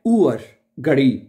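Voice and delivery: ऊअर said slowly as "oo-er" before घड़ी; German Uhr. Shown as a demonstration. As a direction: model ऊअर carefully for the student. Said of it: "समझा रही है ना बात आपको". (ऊअर धीरे से जर्मन तरीके से)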